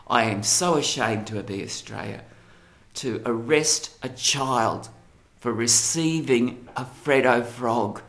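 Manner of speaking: ordinary delivery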